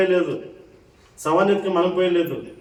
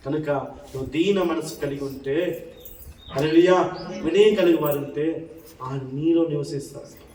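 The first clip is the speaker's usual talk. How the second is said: usual speaking voice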